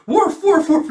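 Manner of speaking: high, loud yelping woof; excited dog bark. Acted. Dog Bond barks frantically.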